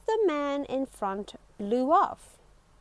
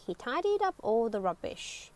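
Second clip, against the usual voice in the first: ordinary delivery, nothing marked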